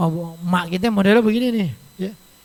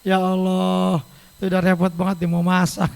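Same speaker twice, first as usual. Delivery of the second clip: ordinary talk, nothing marked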